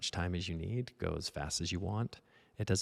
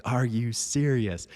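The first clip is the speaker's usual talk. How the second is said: higher, louder, slow and indignant; angry disbelief; 6 semitones higher, 8 dB louder, slower than usual. Retold or imitated. Student fumes at himself after the teacher's praise, loud and indignant. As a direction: let the frustration burst out. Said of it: "I got so angry".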